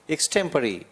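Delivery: एक्सटेम्परी with a light ik, a strong STEM, a light falling pari; stress on STEM. Shown as a demonstration. Teacher drills the stress of एक्सटेम्परी for students. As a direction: lean on the middle of एक्सटेम्परी. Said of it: "ये हल्का, ये जोरदार, फिर ये हल्का गिरा हुआ".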